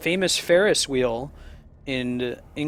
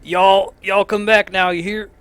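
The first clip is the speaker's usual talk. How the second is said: loud, high mock Southern drawl; atrocious Southern accent; 6 semitones higher, 7 dB louder, slower than usual. Mocking how Europeans do Americans, atrocious Southern accent. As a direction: ham up a bad Southern accent. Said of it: "the really atrocious Southern accent".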